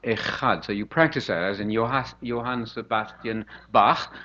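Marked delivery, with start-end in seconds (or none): 0.0-0.6 Echad with a throaty ch; guttural ch in Echad. Shown as a demonstration. Teaching the Hebrew word, guttural ch in Echad. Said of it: "that guttural sound". 3.7-4.1 Bach ending on throaty ch; guttural ch in Bach. Shown as a demonstration. Showing where the sound comes from, ch in Bach. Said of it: "take the ch off the end of Bach".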